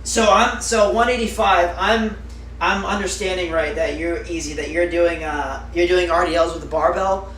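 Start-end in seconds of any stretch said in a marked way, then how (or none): none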